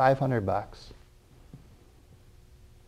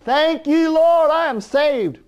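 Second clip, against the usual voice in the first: much higher, slow, gushing thanks; new convert's joy; 4 dB louder, slower than usual. Acted. Preacher mimics a freshly saved convert, much higher and slow. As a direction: act out the overjoyed newly saved churchgoer.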